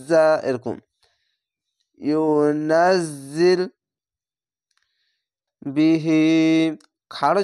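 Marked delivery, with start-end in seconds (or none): 2.0-3.6 ইউনাজ্জিল with a buzzing জা, drawn out slowly. Shown as a demonstration. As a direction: let the জা in ইউনাজ্জিল buzz. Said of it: "জা উচ্চারণটি ঝিনঝিন করে আওয়াজ হবে".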